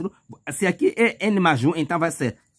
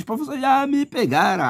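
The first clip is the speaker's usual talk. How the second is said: a higher, whiny student voice; caught-out student; 9 semitones higher than usual. Pretend. Student complaining to the teacher, higher and whiny. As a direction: play the student who got tricked. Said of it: "você diz".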